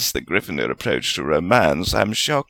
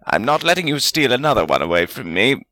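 mildly fierce, pitched up; 5 semitones higher, faster than usual. A narrator's reading. Wizard refusing to lose another pupil, fierce. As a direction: stand your ground in a higher voice. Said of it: "Salazar said fiercely".

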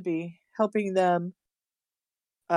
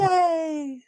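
excited cheer; 8 semitones higher than usual. Real, not acted. Echoed cheer.

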